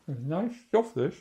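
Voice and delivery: talking through a mouthful; chewing while talking; mouth full. Real, not acted. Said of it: "Excuse me for eating with my mouth full".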